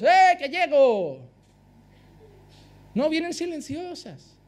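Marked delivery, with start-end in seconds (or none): 0.0-1.2 slow, louder mock call of a snake that announces itself; mock warning shout. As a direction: drag out a loud hammed-up warning. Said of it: "Las serpientes no vienen dando gritos".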